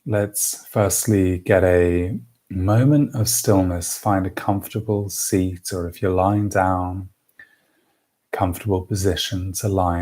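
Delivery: slow, calm breathing-exercise lead; guided meditation. Acted. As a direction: ease them slowly into stillness, pausing for each breath.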